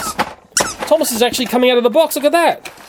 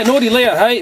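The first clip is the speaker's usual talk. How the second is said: normal talking voice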